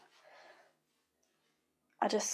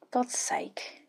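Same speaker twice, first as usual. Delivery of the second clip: exasperated whisper; 2 semitones lower, 10 dB quieter than usual. Real, not acted. Exasperated aside while watching.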